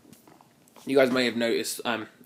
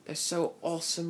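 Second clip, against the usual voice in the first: higher-pitched mock amazement at old graphics; imitated awe; 5 semitones higher, 4 dB quieter than usual. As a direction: gush like an easily impressed young gamer, lightly. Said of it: "you used to think".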